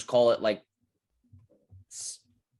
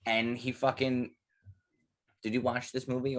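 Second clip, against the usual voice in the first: ordinary talk, nothing marked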